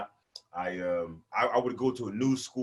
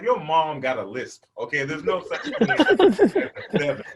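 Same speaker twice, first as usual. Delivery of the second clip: laughing, slow and loud disbelief; mock outrage; 2 semitones higher, 5 dB louder than usual. Teasing a kid about his lisp, mock outraged. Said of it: "I was like".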